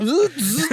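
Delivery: high-pitched mock wheelchair whirr; imitated motor buzz. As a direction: buzz like a little motor jerking into motion.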